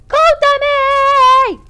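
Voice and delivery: high, loud drawn-out call; long desperate shout. Acted. Saturday calling for Kotomi in the burning house, shouting. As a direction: stretch the call through the fire.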